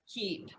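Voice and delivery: keep, long E with lips spread in a smile; keep, long smiling E. Shown as a demonstration. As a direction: stretch the E in keep into a little smile. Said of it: "you're almost doing a little smile".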